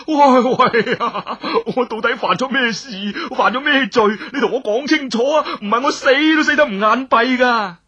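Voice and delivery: loud, high desperate shout; frantic cry of protest. Acted. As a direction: yell it at her, pleading for an answer. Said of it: "就大叫啦".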